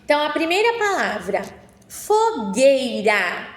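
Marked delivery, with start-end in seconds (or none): 1.9-3.5 fogueira drawn out syllable by syllable; slow dictation. Shown as a demonstration. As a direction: dictate fogueira slowly and clearly. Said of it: "Vou falar pausadamente".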